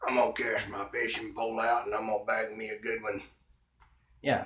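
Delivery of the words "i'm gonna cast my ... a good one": hammed-up country fishing voice; mock country angler. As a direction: put on a backwoods fisherman.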